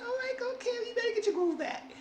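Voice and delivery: squeaky falsetto hype for Carrie; playful high-pitched encouragement; high cheering voice. Retold or imitated. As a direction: cheer her on in a high silly voice. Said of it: "I said".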